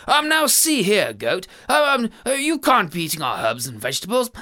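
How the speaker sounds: a timid man trying to sound firm; lightly voiced nervous husband; dithering husband. Husband confronting goat.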